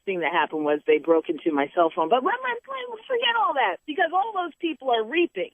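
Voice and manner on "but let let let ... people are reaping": preaching. Real, not acted. Shifts into preaching mode.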